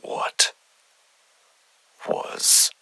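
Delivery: unvoiced, hushed and uneasy; frightened whisper. Investigator alone after recording, whispering in fear. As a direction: breathe the question into the dark. Startled by a sound after suspending.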